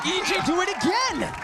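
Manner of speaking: high-pitched hype; excited call. Real, not acted. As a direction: cheer the team's win. Caster calls round result.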